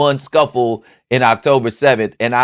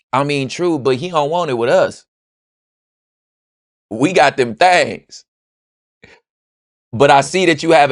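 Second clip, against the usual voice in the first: higher and louder, coaxing; pleading persuasion; 8 semitones higher, 4 dB louder than usual. Pretend. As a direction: talk the homie into backing you. Friend persuading, then agreeing.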